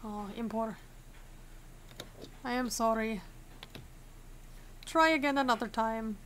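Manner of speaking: lightly voiced Arstotzkan official accent; put-on Arstotzkan accent. Inspector sending an entrant away, mock Arstotzkan accent. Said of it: "gotta stop doing this accent".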